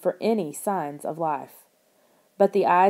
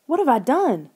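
high, loud despairing question; horrified at what she caused; panicked cry; 6 semitones higher, 4 dB louder than usual. A narrator's reading. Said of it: "Lauren shrieked".

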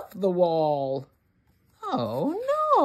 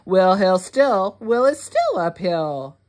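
plain reading voice

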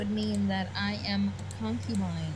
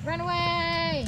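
high, long-drawn wail of retreat; calling out; 7 semitones higher, much slower than usual. Pretend. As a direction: stretch it out as a slow high call. Calls out to flee in game.

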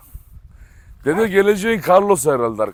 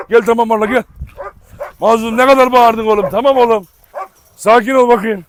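loud calling; 7 semitones higher, 8 dB louder than usual. Real, not acted. Yelling at barking dogs.

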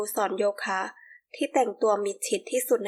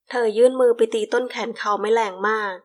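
ordinary delivery, nothing marked

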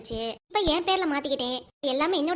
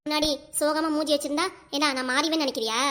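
ordinary delivery

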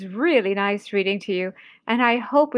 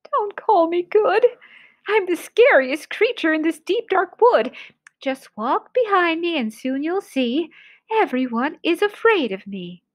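boastful little mouse, pitched up; small creature talking big; 7 semitones higher than usual. Acted. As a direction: brag in a higher mouse voice.

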